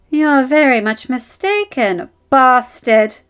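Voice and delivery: high, loud mocking prince voice; a haughty young prince sneering, lifted and loud. Prince taunting the bastard: loud high sneer. Provoked prince answers Jon.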